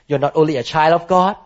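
usual speaking voice

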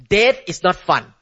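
ordinary talk

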